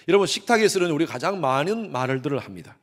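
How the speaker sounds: normal talking voice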